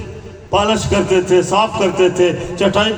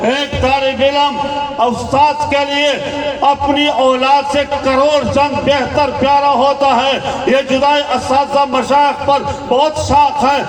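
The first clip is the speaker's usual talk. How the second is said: raised-pitch, heartfelt oration; impassioned preaching; 6 semitones higher than usual. Acted. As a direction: plead on the students' and teachers' behalf, voice held high. (بلند سُر میں پُرجوش خطابت)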